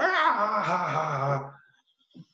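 sergeant's big laugh, acted out; high mock guffaw falling away in spaced ha's; imitated hard laugh. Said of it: "Man laughed so hard".

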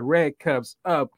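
building hype announcer intro; ring announcer. Acted. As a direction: call his name like a ring announcer. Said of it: "to the one and only".

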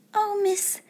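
put-on high, panicky servant girl; high-pitched flustered alarm; frightened stutter. A narrator's reading. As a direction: plead in a small high voice. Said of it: "she stuttered".

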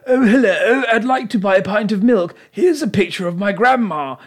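breezy upper-class shopper voice; posh royal voice. Retold or imitated. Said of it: "for them, it's like".

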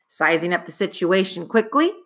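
ordinary delivery, nothing marked

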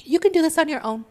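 wheedling ego talking you out of healing; the ego's voice. Retold or imitated. The ego coaxing the listener to skip healing, high and wheedling. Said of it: "the ego constantly is in the background saying".

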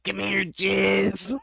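slow, high raspy call. Retold or imitated. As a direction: drag it out in a raspy rasp. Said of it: "it was so raspy".

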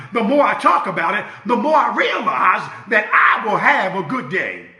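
loud, rising sermon climax with shouts; fiery Sunday preaching; preaching at full force. Acted. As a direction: whip up the congregation.

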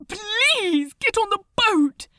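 high, loud, fast and desperate; urgent pleading. Acted. The angel begging Crowley to listen, high and frantic. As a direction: beg him to listen, near tears.

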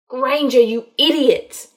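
loud, angry scolding; exasperated anger. A narrator's reading. Man hauling a struggling woman away, exasperated anger. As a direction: scold loudly, out of all patience.

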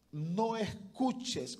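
"no" drawn out slowly and weighted; stress on no. Shown as a demonstration. Preacher warning his brothers, slow stressed "no". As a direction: lean hard on "no escuches". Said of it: "oiga bien esta palabra".

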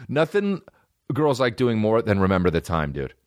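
ordinary talk

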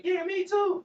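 high mocking squeak of a salesman; mocking Mister Me Too. Friend mocks the bragging salesman, high squeaky parody. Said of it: "I jumped off the landed on my feet".

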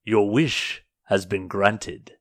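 slow, portentous genie voice; monster genie. Acted. Genie granting the wish after pausing to think, slow and portentous. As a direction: deliver it slowly, with weighty pauses. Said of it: "and he says".

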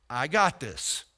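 dropped low and soft, reassuring; hushed low divine reassurance; low calm voice of God. Retold or imitated. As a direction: say it deep and quiet as God. God's voice quoted.

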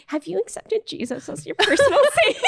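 high, laughing door-to-door preacher; preachy evangelist. Retold or imitated. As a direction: ask it like an eager missionary, laughing. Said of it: "And I'd be like".

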